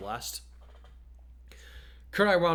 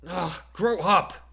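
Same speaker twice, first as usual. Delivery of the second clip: loud, slow, higher snarl; 6 semitones higher, 6 dB louder, much slower than usual. A narrator's reading. Jiro scolding a whiny teammate, loud slow snarl. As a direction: spit it out in disgust. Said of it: "Jiro snarled".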